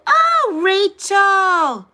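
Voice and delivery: high, loud pained cry; high loud sympathetic shriek; shocked outcry. Real, not acted. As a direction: gasp out the name in shock.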